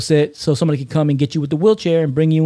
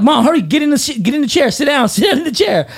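higher, urgent push to get mom seated; hurried urging; 8 semitones higher than usual. Retold or imitated. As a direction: rush her into the chair, half laughing.

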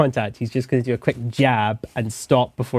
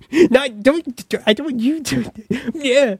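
high squeaky protest; 13 semitones higher than usual. Acted.

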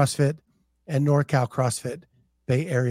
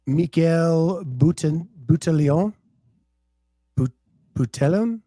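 attempted French accent. Host attempting a council member's French name, tentative French accent. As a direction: try French pronunciation, questioning the surname. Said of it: "Trying to do my best French accent".

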